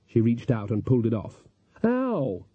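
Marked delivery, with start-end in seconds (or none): 1.8-2.4 a sharp, high yelp of pain; sharp high ouch; sudden ow. A narrator's reading. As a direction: yelp as if the mask tore something. Mask pulled off Magrat.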